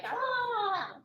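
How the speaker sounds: high short shriek of a wounded spider; spider pain shriek. Acted. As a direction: screech like a spider shot in the throat. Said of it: "My impression of a spider's uvula getting shot".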